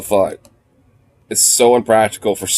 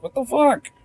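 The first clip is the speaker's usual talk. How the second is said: much higher, squeaky disbelief; mock shock; 3 dB quieter than usual. Telling how the dog woke him, a high squeaky what-the. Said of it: "And I was like".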